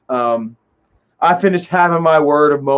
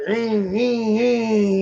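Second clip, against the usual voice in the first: engine hum; 6 semitones higher, 3 dB quieter, much slower than usual. Retold or imitated. Buddy revving the engine.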